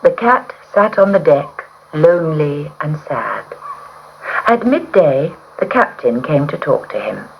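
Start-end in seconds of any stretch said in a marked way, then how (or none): none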